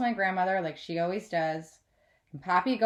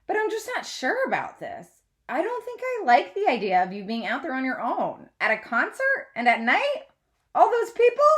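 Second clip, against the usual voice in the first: lightly voiced worried mom; 5 semitones higher, 4 dB louder than usual. A narrator's reading. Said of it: "Mommy says gently".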